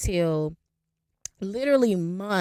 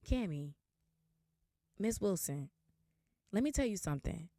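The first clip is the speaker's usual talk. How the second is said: much softer, measured, with weighty pauses; hushed confiding advice; 12 dB quieter than usual. Retold or imitated. DMV man imparting advice to Miss Wilson, hushed and grave. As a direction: lean in quietly as the wise older advisor.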